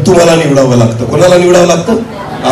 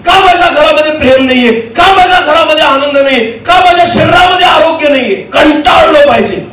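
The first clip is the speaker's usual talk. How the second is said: raised, exhorting sermon voice; impassioned preaching; 10 semitones higher than usual. Acted. Preacher urging congregation to be fed up, impassioned preaching. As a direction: hammer out a chain of anguished questions.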